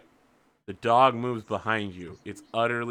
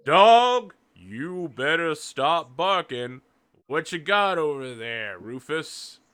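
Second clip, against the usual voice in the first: slow, drawn-out farmer hollering at his dog; slow-moving human; 5 semitones higher, 6 dB louder, slower than usual. Acted. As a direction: call out long and slow to the dog. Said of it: "you can see a slow-moving human like".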